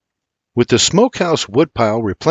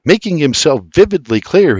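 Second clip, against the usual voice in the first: plain reading voice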